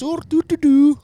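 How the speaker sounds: very high squeaky mock Irish "do, do"; mock Irish singsong. Friend mocking the Irish, squeaky high mock Irish. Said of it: "this Irish is just".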